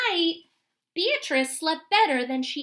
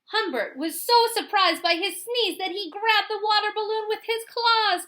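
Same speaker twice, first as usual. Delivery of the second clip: higher, louder building telling; excited narration; 7 semitones higher, 4 dB louder than usual. A narrator's reading. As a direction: tell the climax with rising excitement. Story climax, excited narration.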